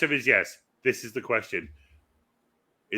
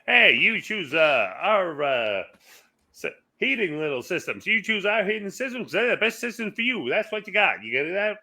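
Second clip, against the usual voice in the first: high-pitched vintage ad patter; old commercial pitchman; 8 semitones higher, 5 dB louder than usual. Acted. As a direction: sell it like an old American commercial. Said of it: "It was because of the commercials".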